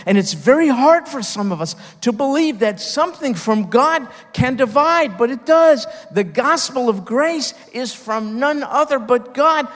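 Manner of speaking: high, loud fervent preaching that keeps climbing; rising preaching. Real, not acted. Preacher building to a climax.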